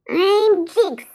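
high squeaky mouse voice; a cocky little mouse, pitched very high; cheeky mouse. Acted. Jiggs the mouse introducing himself to Campbell, high and squeaky.

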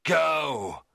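high, angry dismissal; crying out. A narrator's reading. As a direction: cry it out to banish him. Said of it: "I cried".

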